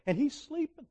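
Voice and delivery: normal talking voice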